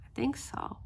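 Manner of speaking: whispered aside, unvoiced. Real, not acted. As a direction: breathe it out as a half-sure aside. Unsure aside.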